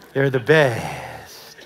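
low, very slow comic wrestler voice; Nacho Libre impression. Preacher quoting a film hero about candy eggs, slow comic luchador voice. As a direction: drag it out in a goofy luchador voice. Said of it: "In the words of Nacho Libre".

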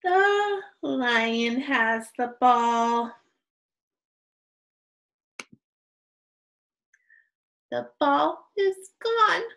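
slow, projected picture-book reading; deliberate beginner read-aloud; reading to children. Shown as a demonstration. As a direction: read each sentence slowly, stretching key words, with long stops after.

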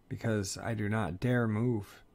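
ordinary delivery, nothing marked

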